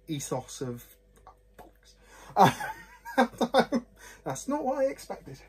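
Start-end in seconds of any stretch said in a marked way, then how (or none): 2.4-5.4 laughing, much higher in surprise; real amused surprise; surprised laugh. Real, not acted. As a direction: caught off guard, laughing. Surprised at the glass result.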